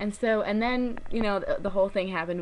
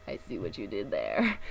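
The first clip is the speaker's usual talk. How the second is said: knowing deep aside; 10 semitones lower than usual. Retold or imitated. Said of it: "it's like".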